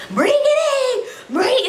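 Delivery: high, slow, stretched yell with a laugh; sergeant screaming. Retold or imitated. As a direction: belt it like a sergeant urging you to the finish. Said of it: "when they scream".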